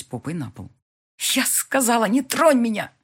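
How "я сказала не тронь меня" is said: hissing through teeth. A narrator's reading. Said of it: "шипит сквозь зубы".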